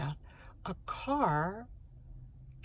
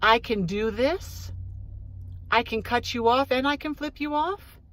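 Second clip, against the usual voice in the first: loud, higher sing-song playground jeer; mocking taunt; 4 semitones higher, 7 dB louder than usual. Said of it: "Is he flipping off, me off to say".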